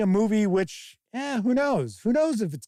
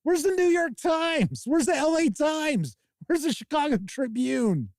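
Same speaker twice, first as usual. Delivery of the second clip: much higher, exasperated mock outrage; incredulous rant; 10 semitones higher than usual. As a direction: demand where the real critics went. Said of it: "It's like".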